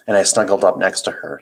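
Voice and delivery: plain reading voice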